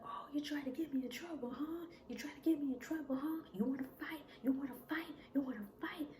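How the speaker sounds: fast, high-pitched imitated threats; aggressive taunting. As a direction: goad them into a fight, rapid and high. Boyfriend attacks, challenging her to fight.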